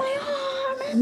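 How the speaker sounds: sudden cry of pain. Real, not acted. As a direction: yelp it out, startled.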